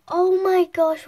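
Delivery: sharp gasp, then a loud, high cry; excited gasp. Real, not acted. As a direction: gasp and burst out in delight. Sees a colour she loves.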